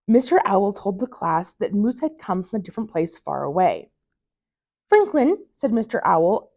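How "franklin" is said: high, loud call of a name; teacher calling a pupil, higher and louder. A narrator's reading. Teacher calls Franklin's name.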